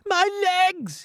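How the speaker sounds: high-pitched outraged cry; angry scream. A narrator's reading. Centipede covered in purple paint, angry scream. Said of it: "The centipede screamed with anger".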